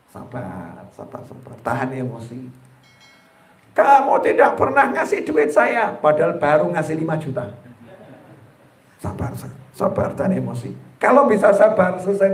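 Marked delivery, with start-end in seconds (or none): 0.1-2.5 calm yourself down under your breath; hushed mantra to keep his temper; self-soothing whisper. Pretend. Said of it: "calming himself down". 3.7-5.9 mock nagging wife. Wife's angry complaint voiced. 9.0-10.7 calm yourself down under your breath; hushed mantra to keep his temper; self-soothing whisper. Pretend. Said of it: "calming himself down".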